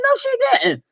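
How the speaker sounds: high squeaky disbelief; shocked sass. Pretend. Vlogger reacting to the tweet, high squeal. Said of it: "I'm like".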